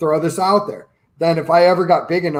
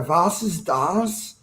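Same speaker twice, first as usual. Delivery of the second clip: drawn-out, quieter mock German; terrible German accent; 6 dB quieter, much slower than usual. Shown as a demonstration. Co-host showing he can do German accent terribly, bad German accent. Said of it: "I can do a German accent terribly".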